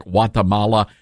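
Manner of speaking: Guatemala said with the G dropped; silent G. Shown as a demonstration. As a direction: start Guatemala on a W sound. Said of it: "it is silent on".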